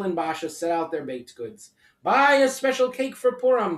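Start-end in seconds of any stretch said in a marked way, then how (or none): 2.0-3.8 higher, louder market call. A narrator's reading. Basha calling to buyers at the market, loud. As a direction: hawk cakes to the crowd. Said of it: "Basha called".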